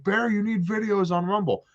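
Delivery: high-pitched nagging imitation; mock nagging. Imitating everybody pestering him about Rumble, mock nagging. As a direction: whine it high, mocking the complainers.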